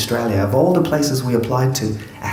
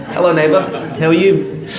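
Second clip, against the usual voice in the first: louder, higher imitation of a nosy neighbour; nosy neighbour greeting; 5 semitones higher, 4 dB louder than usual. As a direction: call brightly over the fence as a nosy neighbour. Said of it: "the ones that peer over the fence".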